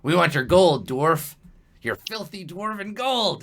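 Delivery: high, scornful goblin shakedown; goblin demand. Acted. Goblin with a sword demands the dwarf's gold, high and scornful. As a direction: demand it with relish. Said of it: "he's like".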